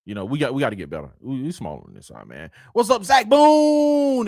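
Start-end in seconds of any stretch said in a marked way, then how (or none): 2.7-4.3 high, loud, long-drawn name; hollered greeting. Real, not acted. Host greeting a viewer by full name, drawn-out holler. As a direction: stretch the name out like a big hail.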